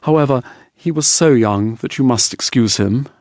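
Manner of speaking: ordinary delivery, nothing marked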